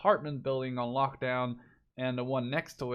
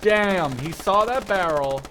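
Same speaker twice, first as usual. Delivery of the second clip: loud, higher shocked exclaim; excited exclaim; 5 semitones higher, 10 dB louder, slower than usual. Real, not acted. Commentator reacting loudly as a soldier gets shot up. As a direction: blurt it out in shock.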